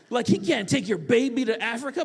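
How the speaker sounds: ordinary talk, nothing marked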